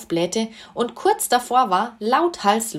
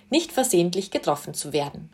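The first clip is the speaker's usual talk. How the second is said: plain reading voice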